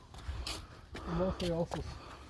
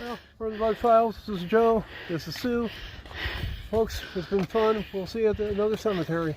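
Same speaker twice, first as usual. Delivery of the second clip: announcer sign-off; 4 semitones higher, 7 dB louder than usual. Acted. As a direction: wrap up like a TV host. Signing off the channel video.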